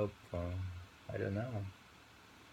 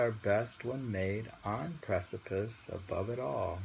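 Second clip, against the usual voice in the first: higher, louder declaimed lines; reading the last two lines on request, louder; poem reading; 3 semitones higher, 4 dB louder than usual. Acted. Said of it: "Read the last two lines".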